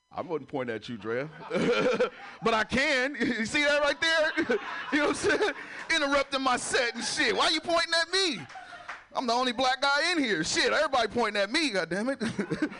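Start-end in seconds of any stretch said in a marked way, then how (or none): 1.5-12.7 high, laughing protest. Real, not acted. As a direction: laugh through the bit. Audience points at him, laughing.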